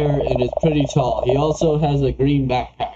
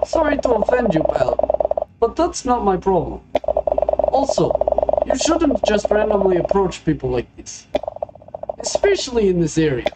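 higher-pitched put-on Russian accent; 4 semitones higher than usual. Gas station customer to a searching stranger, Russian accent. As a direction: play a curt stranger with a light Russian accent. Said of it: "I don't know why I made him Russian".

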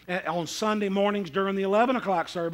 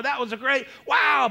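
high, gushing imitation; mock amazement; 5 semitones higher than usual. Preacher mimics awed churchgoers after service, mock-amazed. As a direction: break off into an overdone wow.

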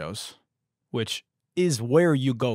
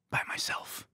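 whispered, softer emphasis; pointed whisper; 8 dB quieter than usual. Acted. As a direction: drop into a whisper to make the point. Acting out wanting to work silently.

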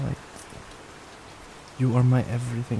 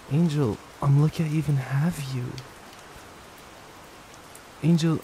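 ordinary talk

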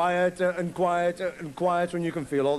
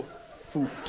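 whispered "Foot" like a silent fart; quiet unvoiced puff of a fart; soft breathy fart noise; 5 semitones lower, 8 dB quieter than usual. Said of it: "They just sort of go".

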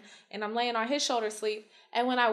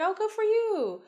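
mock cheerful; 10 semitones higher, slower than usual. Mock reply to kid.

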